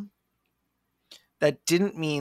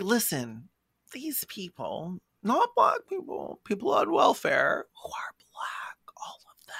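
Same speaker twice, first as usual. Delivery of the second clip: stage whisper; 5 semitones higher, 4 dB quieter than usual. Retold or imitated. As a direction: hiss it like a secret everyone hears. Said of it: "You're whispering, but we can hear you".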